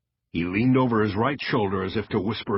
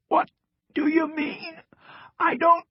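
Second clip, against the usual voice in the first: halting, strained rasp; raspy; 3 dB louder than usual. A narrator's reading. Cross answers the agent questioning him, raspy. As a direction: rasp it out in broken pieces. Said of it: "Cross rasped".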